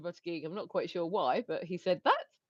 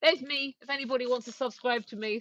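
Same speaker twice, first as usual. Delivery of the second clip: normal talking voice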